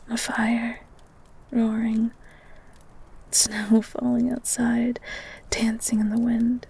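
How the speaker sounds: soft breathy recounting of the book; dreamy whisper. Acted. Girl dreamily tells the beast about the book, whispering. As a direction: whisper as if lost in the story.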